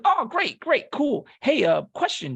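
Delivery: high, over-pleased recruiter imitation; breezy recruiter. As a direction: brush past it cheerfully to pop the question. Recruiter breezily leading to big ask.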